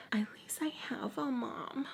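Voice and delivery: very soft, whispered jab; spiteful whisper. Retold or imitated. Girl looking over at her rival, spiteful whisper. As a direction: whisper the dig, imitating a mean girl.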